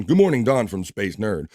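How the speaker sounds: ordinary talk, nothing marked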